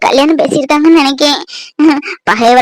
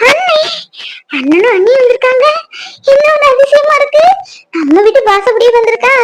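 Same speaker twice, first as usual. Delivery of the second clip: excited welcome; 8 semitones higher than usual. Acted. Sister greets visiting brother excitedly.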